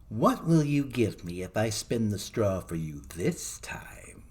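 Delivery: slow, sly imp voice fading to a breath; creeping, drawn-out creature voice; little man voice. A narrator's reading. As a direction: bargain slowly like a crafty gnome. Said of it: "and said".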